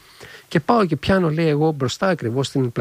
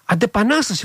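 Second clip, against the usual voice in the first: a mild mock yell, pitched higher; half-shouting the guards' cry; retold shout; 6 semitones higher than usual. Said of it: "φωνάζουν, λέει". (μισοφωναγμένη κραυγή των φρουρών)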